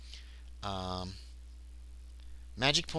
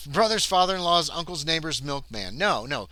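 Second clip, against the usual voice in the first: ordinary delivery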